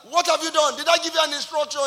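loud, high retold dressing-down; angry confrontation. Uncle confronting the bank manager over moved money, loud and angry.

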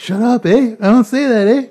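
high, loud indignant retort with eh; mock Canadian retort. Speaker retorting to teasing cousins, high, loud mock indignation. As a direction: snap back, giving yourself away. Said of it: "I'm just like".

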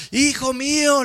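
loud, high cry to her son through the bars; mother shouting. Retold or imitated. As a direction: shout it out to the child behind the bars. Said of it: "le gritaba la madre". (grito desesperado de madre)

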